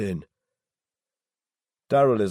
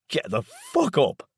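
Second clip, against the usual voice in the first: higher, slow, cracking with rage; voice breaking with anger; 8 semitones higher, 4 dB louder, slower than usual. A narrator's reading. Laz wakes his stepfather, voice breaking with anger. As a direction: spit it out, voice cracking from fury. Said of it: "my voice breaking with anger".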